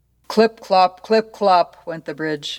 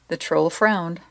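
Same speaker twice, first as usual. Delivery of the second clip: usual speaking voice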